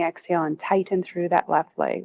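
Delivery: ordinary talk, nothing marked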